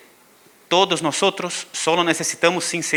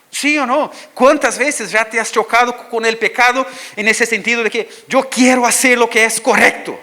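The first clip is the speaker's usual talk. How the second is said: higher and louder pulpit appeal; preaching at full force; 7 semitones higher, 4 dB louder than usual. Acted. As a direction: challenge the congregation fervently. (predicación con fuerza y en alto)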